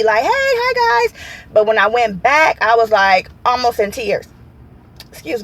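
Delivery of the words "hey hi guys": high, cheery greeting imitating her usual self; upbeat, happy. Patient showing her normal upbeat greeting to clinic staff, upbeat and happy. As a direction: sing out a bubbly hello, much higher. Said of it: "I'm normally upbeat, happy".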